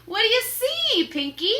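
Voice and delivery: high, loud call up the ladder; shout. Acted. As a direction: shout up to the crewman. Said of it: "shouted Captain Bones".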